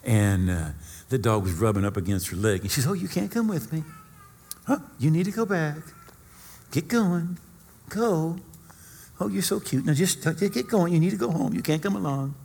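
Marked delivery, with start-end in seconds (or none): 2.9-12.4 high, coaxing lady talking to her dog; bossy little princess. Retold or imitated. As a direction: shoo the little dog away, sweetly. Woman shooing her little dog.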